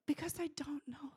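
high, hushed, near-whispered sorrow; emotional. Real, not acted. Preacher overcome for people who don't know, hushed and emotional. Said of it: "Sorry, we're so emotional".

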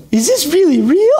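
high, excited wonder; amazed disbelief. Retold or imitated. Pastor marveling at his coming project, high amazed. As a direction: marvel at good news, pitch leaping up. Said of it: "I'm just like".

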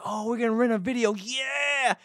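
put-on very high-pitched excited kid sister; freaking out excitedly. Sister thrilled about the video store trip, high excited imitation. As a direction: squeal it in mock childlike glee. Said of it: "My sister freaked out".

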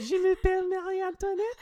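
very high, loud falsetto French voice; hammed-up falsetto French accent; squeaky French girl voice. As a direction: squeak it like a tiny French princess. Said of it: "That's how you do it?".

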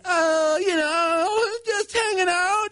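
very high, strained mock-casual; weird voice. Son fakes casual on the phone to dad, weird high voice. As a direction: squeak it out, badly faking normal. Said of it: "Your voice sounds weird".

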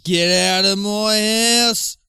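long, drawn-out high yell; mock-furious shout. Husband throwing honey out, hammed-up drawn-out shout. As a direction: bellow it high, stretching the words. Acted quarrel ends in eviction.

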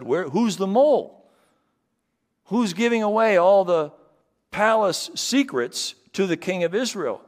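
loud, high, exasperated demand; frustrated king. Acted. King of Syria furious at the leak, loud and high. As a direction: demand to know who the traitor is. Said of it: "got really frustrated and said".

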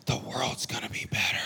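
sarcastic hushed whisper; mock whisper. Pastor mocking a hope in politics, sarcastic whisper. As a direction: breathe it out as a mocking secret.

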